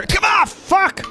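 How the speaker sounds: squeaky, slow curse from offstage; high cursing gnome voice. Acted. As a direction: yell it high from the back room. Shopkeeper curses from back room.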